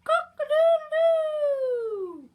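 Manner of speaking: very high, loud, long crow; rooster crow. A narrator's reading. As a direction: crow slowly at full volume like a rooster. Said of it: "crowed the rooster".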